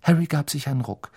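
plain reading voice

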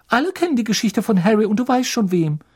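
very high, insistent schoolgirl voice; defiant Hermione. Acted. Hermione defies the teacher. (Hermine, sehr hoch und trotzig)

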